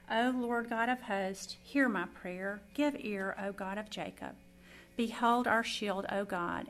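loud, measured psalm reading; scripture reading. Acted. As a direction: proclaim each verse solemnly. Reads Psalm 84 aloud.

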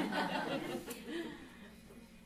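a relieved laugh after tears; teary laugh. Real, not acted. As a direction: laugh it out in release.

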